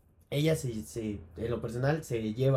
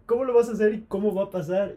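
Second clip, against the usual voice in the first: higher, louder and fast, in a rush of disbelief; fast, higher, louder mock amazement; excited disbelief; 6 semitones higher, 4 dB louder, faster than usual. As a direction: blurt it out as if glued to the screen. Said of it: "en la pantalla diciendo".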